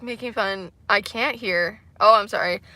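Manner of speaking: normal talking voice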